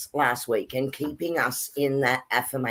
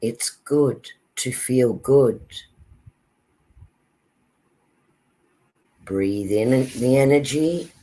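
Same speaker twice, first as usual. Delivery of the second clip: slow, calm meditation voice; calm grounding exercise; 4 semitones lower, slower than usual. Acted. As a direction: guide them gently into calm. Leads a meditation.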